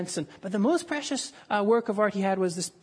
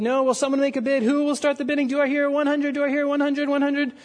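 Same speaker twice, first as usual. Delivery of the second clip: higher, louder pleading auction call; auctioneer calling for bids; 6 semitones higher, 4 dB louder, faster than usual. Retold or imitated. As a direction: coax a bid from the room. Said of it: "The auctioneer said".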